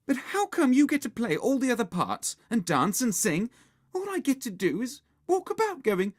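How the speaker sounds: high, loud whiny complaint; sour. A narrator's reading. Susan complains to bossy Margaret, sourly. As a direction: grumble about an unfair part. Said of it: "said Susan sourly".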